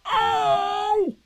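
loud high drawn-out wail; a long, high "oh" of dismay; Pooh. Acted. Bear bumping in a jar, wailing loudly. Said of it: "he said inside the jar".

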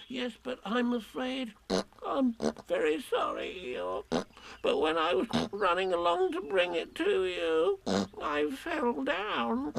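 high, halting, apologetic small animal; Piglet. A narrator's reading. Piglet confesses his fall to Eeyore, high and apologetic. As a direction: confess it in a thin piping voice.

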